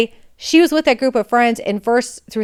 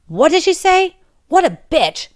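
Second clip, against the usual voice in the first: furious outburst; 8 semitones higher than usual. Retold or imitated. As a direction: blow up in sudden outrage. Teacher furious after hearing lie.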